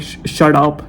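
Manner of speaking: normal talking voice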